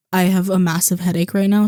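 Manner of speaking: ordinary talk, nothing marked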